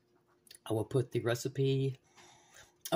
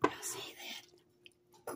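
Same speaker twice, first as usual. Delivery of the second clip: soft breathy whisper; hushed, under the breath; 8 dB quieter, much slower than usual. Real, not acted. Peeking at the finished dish.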